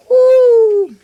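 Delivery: sudden high excited woo; high whoop. Real, not acted. Whooping at his friend in excitement. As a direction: let out a wild whoop.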